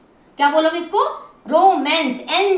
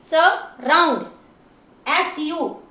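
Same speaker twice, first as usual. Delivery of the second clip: Surround slowly, as स and round; slow, segmented surround; syllable by syllable; slower than usual. Shown as a demonstration. Teacher splits word into syllables.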